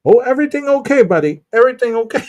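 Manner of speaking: high-pitched imitation of a concerned local; mock high-voiced helper; imitated local. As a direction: pipe up high, checking on a buddy. Imitates the locals checking on him.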